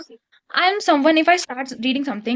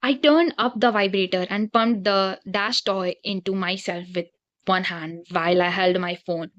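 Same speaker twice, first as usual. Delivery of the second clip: low, soft, level reading voice; reading aloud; 6 semitones lower, 4 dB quieter than usual. Acted. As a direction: read it out low and subdued. Reads the novel aloud.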